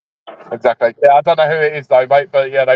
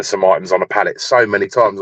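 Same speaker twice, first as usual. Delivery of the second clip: usual speaking voice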